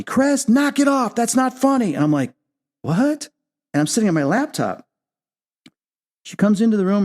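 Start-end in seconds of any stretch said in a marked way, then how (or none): 0.0-1.9 imitating a mother yelling at her son; mock yelling. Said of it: "she screams and yells at me". 2.8-3.3 high-pitched baffled "what"; mock bafflement. Retells his baffled reply.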